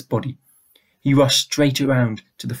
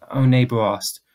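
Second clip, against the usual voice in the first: plain reading voice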